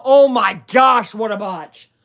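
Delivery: loud, high, slow gasp of disbelief; shocked exclaim. Real, not acted. Streamer reacting to the Steelers' botched timeout, loud and high. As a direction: react as if you can't believe it.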